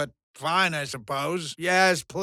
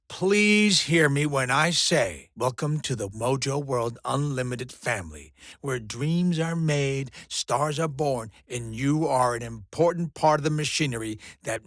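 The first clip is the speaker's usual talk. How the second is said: mock-solemn sincerity; 5 semitones lower than usual. Boss welcomes newcomer to the company family, solemnly sincere. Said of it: "So in all seriousness".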